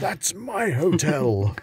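slow, high gloating boast; gloating Monopoly player. Pretend. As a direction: crow over the hotel, drawn out. Acting royal Monopoly game.

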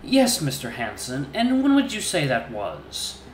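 high, slow, measured questioning; a polished hyena prince, coolly probing; calm interrogator voice. Acted.

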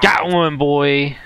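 loud, high victory cry; triumphant shout. Real, not acted. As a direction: cheer the hit. Hits barrel, triumph.

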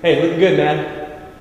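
normal talking voice

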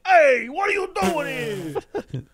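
loud-mouthed New Yorker, high and drawn out; New York guy. Acted. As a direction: holler it like a New York street heckler. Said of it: "my New York guy".

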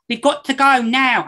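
high, loud mock London accent with Scouse creeping in; hammed-up London accent, Scouse showing through; bad London accent. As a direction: imitate a child actress's dreadful London accent, pitched high and loud. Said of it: "she has the worst London accent ever".

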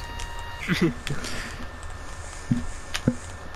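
very quiet, breathy chuckle; soft laughter. Real, not acted. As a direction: laugh under your breath at a friend's joke. Laughs at burger joke.

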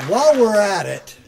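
loud, rising, defiant announcement. Pretend. Governor defying Washington over federal tax, loud defiant call. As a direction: throw it at Washington with a grin.

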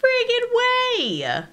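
high, slow disbelief; disbelieving squeal. Real, not acted. Gamer squealing at a setback. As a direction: stretch it out in disbelief.

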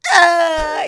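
loud high crying wail; imitated loud crying burst. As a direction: let out a loud sobbing cry.

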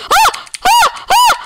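high startled screams; scared shriek. Real, not acted. Gamer shrieks at a sudden Enderman. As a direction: yelp in sudden fright.

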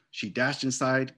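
ordinary delivery, nothing marked

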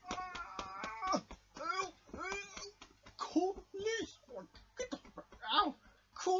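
high, hammed-up cries for help; mock panic. Headband begs George to stop Crash, high mock panic. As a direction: yelp for help like a cartoon victim.

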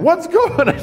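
put-on high, loud child's voice asking; little girl. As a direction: play a small girl running in, pitch way up. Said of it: "the little girl comes running in".

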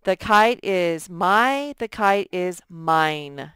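slow, careful side-by-side read; slow comparison read. Shown as a demonstration. Teacher compares two pronouns slowly for the class. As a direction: read both slowly so kids hear the difference. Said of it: "We would use the word mine".

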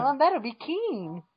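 put-on high, slow wholesome child voice; fifties sitcom kid. Kid talking to Mom in spoof, hammed-up sitcom kid. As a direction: draw it out like an old-TV kid.

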